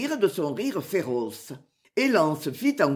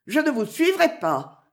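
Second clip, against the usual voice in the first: firm; 7 semitones higher, 3 dB louder than usual. A narrator's reading. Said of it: "répéta fermement Marianne".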